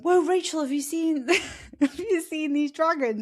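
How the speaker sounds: wide-eyed wonder breaking into a laugh; amazed gasp. Retold or imitated. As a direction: gush over the dragons like a delighted friend. Friend gasps at dragon statues.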